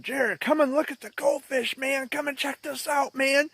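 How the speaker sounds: high-pitched coaxing, imitating an eager dad; diverting dad. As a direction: lure a child's attention away with high, excited urging. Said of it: "my dad would always try to divert my attention".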